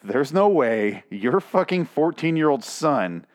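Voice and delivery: very slow, weighted word by word; slow disbelief. Real, not acted. Reader doubts the father's quote, slow emphatic disbelief. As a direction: drag it out, utterly incredulous.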